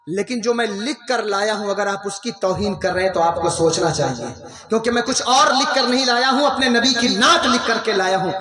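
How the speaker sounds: ordinary talk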